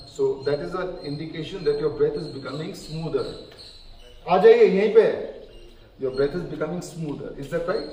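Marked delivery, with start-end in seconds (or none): 4.3-5.3 loud, quick call, raised in pitch; calling someone over. Real, not acted. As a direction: call out briskly to someone arriving. Calls someone over in Hindi.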